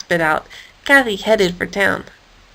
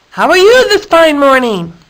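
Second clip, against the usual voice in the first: friendly greeting; 6 semitones higher, 5 dB louder than usual. A narrator's reading. Hippo greeting goat.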